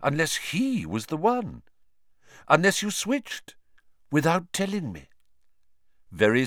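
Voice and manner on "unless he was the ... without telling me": dawning realization. Acted.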